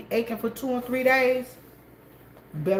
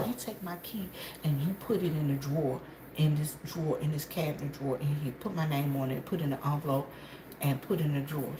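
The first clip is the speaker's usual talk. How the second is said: quoting softly; 5 semitones lower, 9 dB quieter, faster than usual. Retold or imitated. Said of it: "She said".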